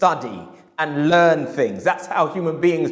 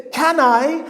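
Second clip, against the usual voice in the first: timid hope; 10 semitones higher, 3 dB louder than usual. Retold or imitated. Customer asking permission.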